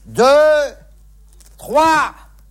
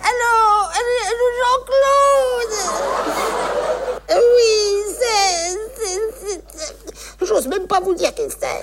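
tearful high voice; 7 semitones higher than usual. Acted. Fake tearful confession on phone.

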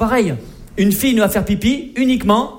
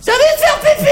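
put-on shrill, much higher girly call; girlfriend's voice; 4 dB louder than usual. Girlfriend calls to Mathieu on a mountain hike, high and whiny.

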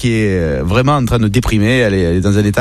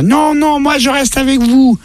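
put-on high, stubborn child voice; little boy voice; 13 semitones higher, slower than usual. Little boy refusing to leave his parents, stubborn and high.

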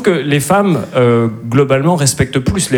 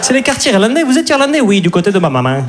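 put-on much higher rom-com line in a Midi accent; southern French accent; 7 semitones higher than usual. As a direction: play a New York romance with the accent du Midi. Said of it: "avec l'accent du Midi". (accent du Midi en comédie romantique, voix plus aiguë)

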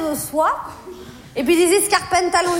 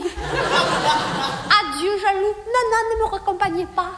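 mock femme fatale; 3 semitones higher, slower than usual. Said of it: "Je suis une femme étrange, fatale et mystérieuse".

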